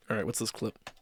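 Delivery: usual speaking voice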